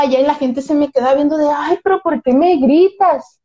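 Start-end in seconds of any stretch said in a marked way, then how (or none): 1.5-3.4 slow, drawn-out wounded protest, imitated; mock complaint. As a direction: imitate someone protesting at being yelled at, stretched out. Imitates people complaining he shouts. (queja imitada, lenta y alargada)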